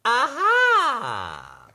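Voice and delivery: high, loud drawn-out aha; page-turn aha. Acted. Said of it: "Our page-turning sound today will be".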